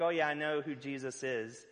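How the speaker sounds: ordinary talk, nothing marked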